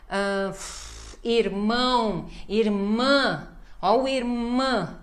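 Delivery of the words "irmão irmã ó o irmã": "irmã" slowly, final nasal MÃ held; emphasis on MÃ. Shown as a demonstration. Teacher showing nasal stress, slow long MÃ in "irmã". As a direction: draw out the last syllable of "irmão" and "irmã". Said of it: "tem que dar ênfase no mã".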